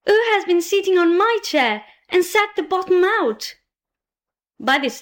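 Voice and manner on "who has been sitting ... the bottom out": high-pitched little bear, indignant; teeny tiny angry voice. A narrator's reading. Tiniest bear finds the chair broken, teeny tiny voice of anger. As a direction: cry out high, small and cross. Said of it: "in a teeny tiny voice of anger".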